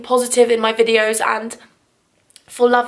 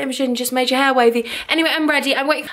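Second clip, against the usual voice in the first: normal talking voice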